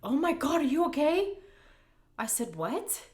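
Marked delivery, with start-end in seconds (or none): 0.0-1.4 mock alarmed, higher and louder; startled concern, higher and louder; husband seeing her bleeding, alarmed and louder. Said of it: "and he goes".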